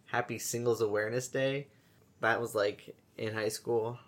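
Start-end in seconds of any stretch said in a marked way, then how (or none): none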